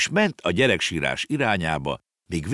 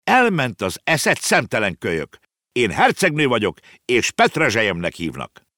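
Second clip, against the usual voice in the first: indignant shout; 5 semitones higher, 3 dB louder than usual. Acted. Offended princess to the boy, indignant outburst. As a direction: scold haughtily, high at first, easing down. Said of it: "felháborodva rákiáltott".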